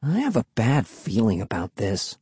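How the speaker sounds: soft, uneasy mutter. A narrator's reading. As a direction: mutter it under your breath. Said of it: "she muttered".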